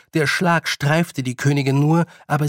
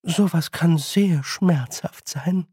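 slow, higher, silky threat; friendly menace; 3 semitones higher, slower than usual. Acted. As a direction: savour the warning slowly. Queen's friendly threat continues. (genüsslich langsame, freundliche Drohung)

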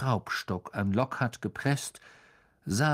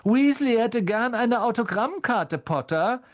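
high, loud schoolboy taunt; scornful bully; mocking; 11 semitones higher, 4 dB louder than usual. A narrator's reading. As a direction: jeer it in a high voice. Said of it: "spottete Malfoy".

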